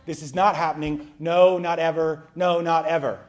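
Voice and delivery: loud, insistent no, not ever; emphatic refusal. Retold or imitated. Peter refuses Jesus washing his feet, a loud flat refusal. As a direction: slam the door on the idea, loudly. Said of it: "That's the way he says it".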